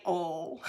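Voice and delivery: soft "all" meant as oil; dialect "oil". Shown as a demonstration. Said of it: "Is that not how you say".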